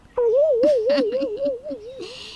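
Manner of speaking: a high burst of laughter. Acted. Daughter laughing at her mother-in-law joke, high laugh. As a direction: laugh at your own joke.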